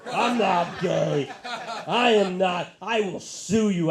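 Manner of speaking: slow, loud, drawn-out denial; over-insist like a touchy star; mock Tom Cruise.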